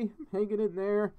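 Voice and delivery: ordinary delivery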